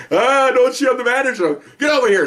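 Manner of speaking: high, loud, frantic bank manager; mock panicked plea. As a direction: act out someone scared of being shot. Bank manager pleading not to be shot.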